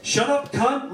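high-pitched furious outburst; angry scream. A narrator's reading. Director silencing his actress, angry scream. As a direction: shriek the insult in anger. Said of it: "Larry screamed at her".

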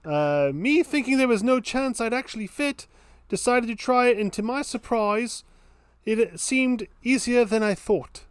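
high, put-on Cockney accent. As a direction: read it like a Cockney Londoner. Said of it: "Can you do it in a Cockney accent?".

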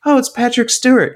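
sudden high delighted recognition; surprised. Retold or imitated. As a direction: gasp out the name in surprise. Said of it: "you're like".

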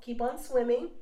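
soft echo of a motto; quiet repeat. Retold or imitated. Repeats motto as own feeling.

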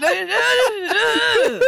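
drawn-out high pleading no; frantic no. Retold or imitated. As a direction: stop him, slow and high. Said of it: "I was like".

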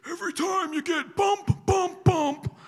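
high-pitched imitation of an old preacher; old saint imitated. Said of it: "That's how he said it".

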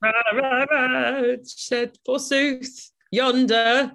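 booming high Shakespearean ham; slow, grand, overacted Bottom; hammy stage actor. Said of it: "that's Shakespearean".

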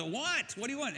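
put-on a groggy boy asking what he wants; woken boy, annoyed. Boy woken a second time.

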